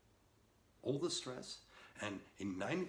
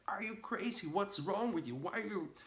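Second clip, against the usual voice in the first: much higher, loud panicked yell; panicked screaming; 11 semitones higher, 6 dB louder than usual. Retold or imitated. Panicked man yelling at his calm friend about the lion. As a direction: scream at him in total panic. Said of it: "screaming in total panic".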